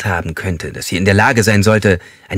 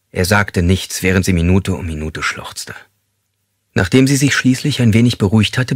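ordinary delivery, nothing marked

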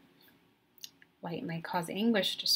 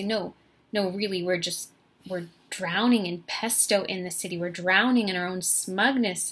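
louder, exasperated insistence to a partner; emphatic protest; 9 dB louder than usual. Retold or imitated. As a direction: argue the point, pushing the key words louder. Insisting in argument with boyfriend.

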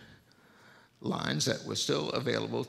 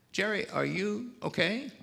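put-on pitched up high, kindly asking a child on the phone; caring phone operator; 9 semitones higher than usual. Operator checks on the lonely boy, high and kindly. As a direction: lift into a gentle operator voice. Operator voiced in a retold story.

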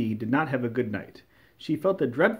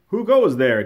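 loud, raised royal challenge; demanding; 3 semitones higher, 10 dB louder than usual. A narrator's reading. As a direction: call out sharply as a demanding king. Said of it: "demanded the king".

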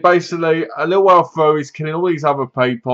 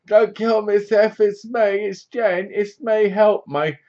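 high, put-on damsel voice; hammed-up high-pitched begging; mock pleading woman; 7 semitones higher, slower than usual. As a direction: plead for mercy in a high imitation. Voicing Jen pleading for her life.

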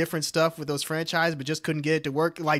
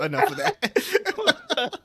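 laughing; 14 semitones higher, much slower than usual. Real, not acted.

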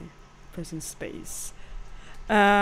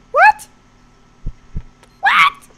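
shrill, loud panicked yells, much higher; frightened screaming; 8 dB louder than usual. Real, not acted. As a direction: shriek it out, genuinely scared by the game. Jump scare in horror game.

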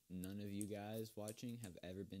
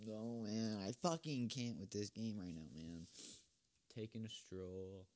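usual speaking voice

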